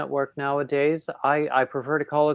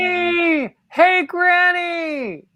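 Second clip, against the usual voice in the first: much higher, slow sing-song call of "Granny!"; 5 dB louder, much slower than usual. Real, not acted. Host greets Granny on air with a slow sing-song call. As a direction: call out to Granny across the room.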